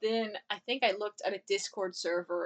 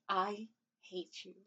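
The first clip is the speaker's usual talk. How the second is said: mock sad; 3 semitones lower, 12 dB quieter, slower than usual. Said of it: "and he's like".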